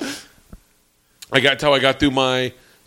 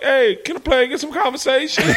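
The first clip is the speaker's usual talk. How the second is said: mock translator voice; 9 semitones higher than usual.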